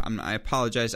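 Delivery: normal talking voice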